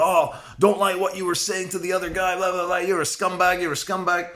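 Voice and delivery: put-on high, jeering drunk voice; mock drunk attacker. Drunk attacker jeering at the vlogger, high mocking sneer. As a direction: sneer it like a drunk picking a fight.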